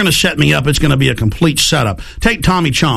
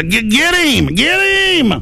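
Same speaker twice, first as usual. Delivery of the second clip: mock cop shout; 12 semitones higher than usual. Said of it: "But, so, like".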